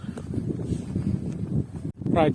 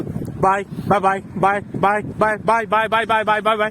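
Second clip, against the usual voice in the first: string of repeated goodbyes, slightly raised pitch; phone-call goodbyes; 4 semitones higher than usual. Retold or imitated. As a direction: mimic someone who can't end a phone call. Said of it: "at the end of the call".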